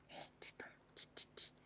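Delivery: soft unvoiced whisper. Real, not acted. Whispering to the child.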